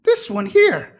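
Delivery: high, loud sneering official; mock her loudly. NPC mocks tiefling loudly.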